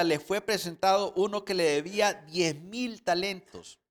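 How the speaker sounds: loud, emphatic sermon reading; fervent preacher voice; forceful preaching. Acted. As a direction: preach the parable out to the congregation.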